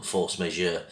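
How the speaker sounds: ordinary talk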